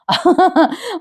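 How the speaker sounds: short high laugh; light high-pitched chuckle; amused laugh. Real, not acted. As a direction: laugh at the character's antics. Amused aside during recap.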